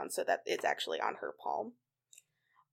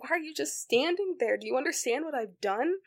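retold anguish; 7 semitones higher, 3 dB louder than usual. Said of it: "she's just so sad".

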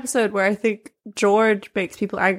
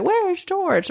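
high mock call-out; imitating puzzled characters; 11 semitones higher than usual. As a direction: mimic everyone asking, pitched high. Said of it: "everyone else is just like".

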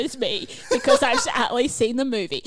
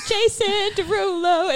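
slow, high drawled name tag; 8 semitones higher, much slower than usual. Retold or imitated. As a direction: stretch the name out like his intro. Singer's own name tag.

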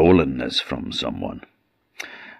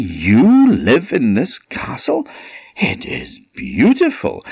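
amazed king; 7 semitones higher, 4 dB louder than usual. A narrator's reading. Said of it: "said the king".

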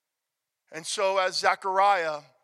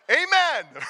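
AMEN loud, high and fully pronounced; loud, clear amen; 5 semitones higher, 5 dB louder than usual. Shown as a demonstration. Preacher shows congregation how to respond, loud clear AMEN. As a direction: belt out a clear two-syllable AMEN.

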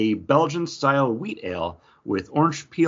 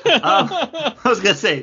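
laughing; 14 semitones higher, 5 dB louder than usual. Real, not acted. Laughing at John's answer.

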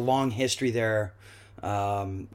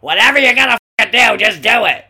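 sharply higher, loud burst, quick and pushed; wife's exasperated yell; 10 semitones higher, 13 dB louder than usual. Retold or imitated. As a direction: fire it out as the fed-up wife demanding action. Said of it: "my wife said".

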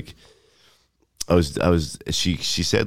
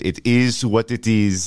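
slow, higher imitation French accent; 5 semitones higher, slower than usual. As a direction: put on a French accent, stretching the words. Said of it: "French accent".

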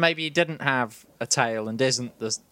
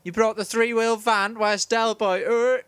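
higher, gushing fan parody; mock eager fan; 6 semitones higher than usual. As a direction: ham up the overkeen fan. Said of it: "you went up to him and went".

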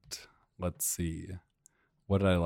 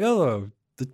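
higher, louder mock family voice; quoting the filming family; 4 semitones higher, 6 dB louder than usual. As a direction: lightly act out the onlookers. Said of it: "It was like".

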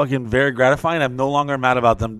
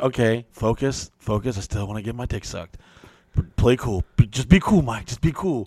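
quiet self-talk; 3 semitones lower, 5 dB quieter than usual. Retold or imitated. Inner self-talk calming down.

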